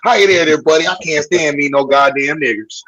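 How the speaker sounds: mock a bigoted good ol' boy American accent. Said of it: "I got my American accent".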